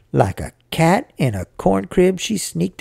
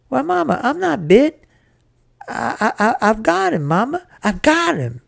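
high, breathless-with-news stammer; excited boy; 9 semitones higher than usual. Acted. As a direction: blurt the proud news to Mama. Excited boy tells of his catch.

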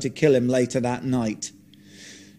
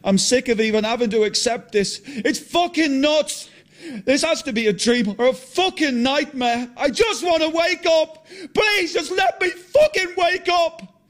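pleading yell, much higher and building; desperate angry shout; 13 semitones higher than usual. Acted. John breaking down on camera to Martin, desperate shouting. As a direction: scream to be woken from the nightmare.

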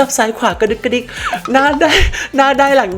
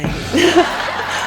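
laughter; 8 semitones higher than usual. Real, not acted. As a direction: laugh at your own joke. Laughing at own threat.

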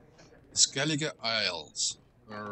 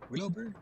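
Willoughby with a high questioning rise; doubtful, lifted Willoughby?; unsure pronunciation; 10 semitones higher, faster than usual. Real, not acted. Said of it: "Is that how you pronounce it?".

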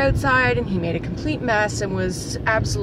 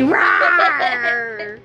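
louder pretend roar for the kid; playful monster roar; 2 semitones higher, 4 dB louder than usual. Mom playing scary monster for her child, mock roar. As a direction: growl like a friendly monster mommy. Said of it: "Scary monster mommy".

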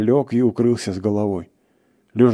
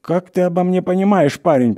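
high, loud wail; acted plaintive moan; moaning protest; 10 semitones higher, 5 dB louder than usual. A narrator's reading. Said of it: "простонал лесник".

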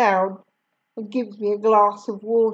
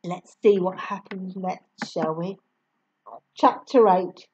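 usual speaking voice

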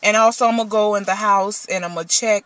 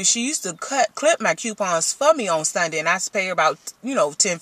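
normal talking voice

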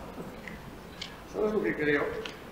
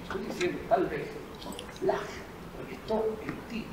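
hushed; 2 semitones lower, 8 dB quieter than usual. Acted. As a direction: lean in and confide. Conspiratorial low suggestion.

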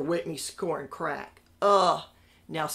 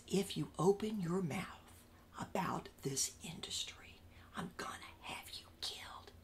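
menacing whisper; 10 dB quieter than usual. Retold or imitated. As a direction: lean in and whisper the death threat. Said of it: "He's leaning into my ear".

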